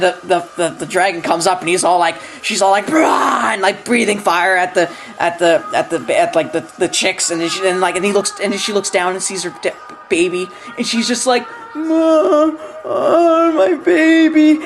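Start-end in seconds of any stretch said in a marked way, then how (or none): 2.9-3.5 short high shouted "Brah!"; mock dragon roar. Dragon roaring at the chicks. 11.7-14.6 much higher, drawn-out melodramatic wail; hammed-up grieving mother. Mother dragon sees dead baby.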